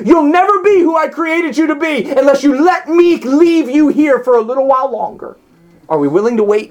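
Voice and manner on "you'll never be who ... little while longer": high, gentle voice of God; voicing God. Preacher voicing God telling the waiting listener to stay. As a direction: speak as God, tender but firm. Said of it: "If God's saying".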